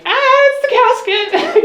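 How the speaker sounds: high, slow mock panicked scream. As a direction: scream it like scared men. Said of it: "screaming and yelling".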